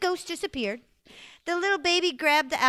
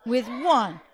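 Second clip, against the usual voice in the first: spooky reveal; 2 semitones lower, much slower than usual. A narrator's reading. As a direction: draw it out to scare the kids.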